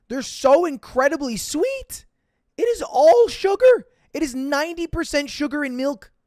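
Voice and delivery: rising to a high, loud outburst; building disbelief that peaks high and loud; indignant rant. Real, not acted. Streamer ranting to chat about sweet coffee drinks, climbing to an outraged high outburst.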